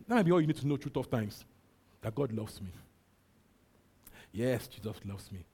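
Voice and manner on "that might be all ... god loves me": ordinary delivery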